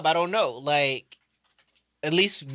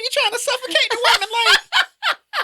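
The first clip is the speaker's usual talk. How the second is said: much higher, fast, disbelieving cry; incredulous outburst; 5 dB louder than usual. Retold or imitated. Reacting to a guy in a clip holding the girl's nose, incredulous high outburst. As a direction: squeal it out in disbelief. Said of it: "And it's like".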